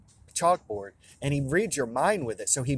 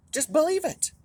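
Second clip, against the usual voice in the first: high pleading. Real, not acted. Repeated plea, much higher.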